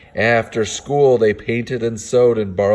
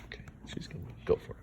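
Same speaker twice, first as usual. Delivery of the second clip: fast whisper to the listener; whispered aside; 19 dB quieter, much faster than usual. Real, not acted. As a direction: lean in and whisper quickly. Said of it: "aside while reading".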